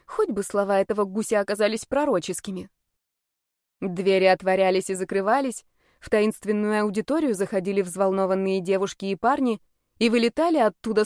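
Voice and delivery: usual speaking voice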